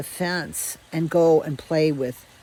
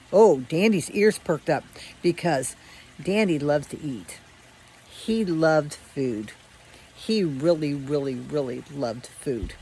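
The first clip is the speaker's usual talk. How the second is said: ordinary delivery, nothing marked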